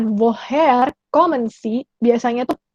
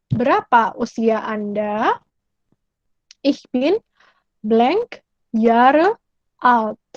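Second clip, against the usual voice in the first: ordinary talk, nothing marked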